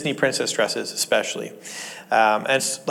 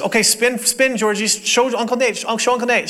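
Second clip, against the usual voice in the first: coaxing mom voice; 8 semitones higher than usual. Retold or imitated. Mom coaxing child on FaceTime.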